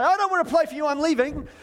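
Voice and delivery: put-on high, loud sulky kid; a mock petulant I'm leaving; huffy refusal. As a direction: storm off like a child leaving the game. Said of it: "You might say".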